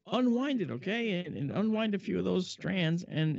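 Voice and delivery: lightly voiced mentor; encouraging boss. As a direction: gently guide him. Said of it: "he goes".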